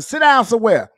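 loud, high "Sit down somewhere"; mom's yell. Retold or imitated. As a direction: bark it like an angry mom. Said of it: "You be running around".

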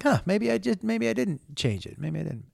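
softly retold second-guessing; puzzled self-talk. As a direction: muse it quietly, trailing off.

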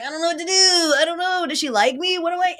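high, whiny, unsure voice; mock flustered Dolphins. Mocking the Dolphins as a shy date, high and whiny. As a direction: whimper like a nervous teen on a date. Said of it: "the Dolphins were like".

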